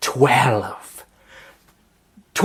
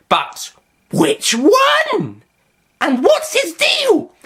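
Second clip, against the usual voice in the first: shrill wail; 4 dB louder, much slower than usual. Acted. Master shrieking.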